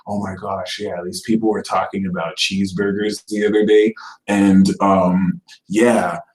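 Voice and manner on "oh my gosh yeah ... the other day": lower, hushed sly gossip; a hint of a sly troll, voice dropped; scheming Scorpio. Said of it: "be like".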